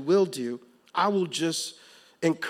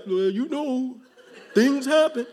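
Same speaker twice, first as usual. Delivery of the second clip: mock excuse; 9 semitones higher, 5 dB louder, slower than usual. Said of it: "and then you like".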